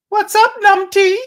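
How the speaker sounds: very high, loud falsetto; playful greeting. Shown as a demonstration. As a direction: call out teasingly in a silly high voice. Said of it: "That's how I'm gonna do it".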